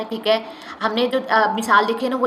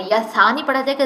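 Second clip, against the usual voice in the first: ordinary delivery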